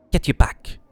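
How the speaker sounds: angry snarl at a stranger; snarling warning. Acted. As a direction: drive them off like a wild animal. Scarred woman snarls at player.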